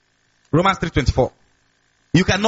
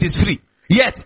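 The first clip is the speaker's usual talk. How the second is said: normal talking voice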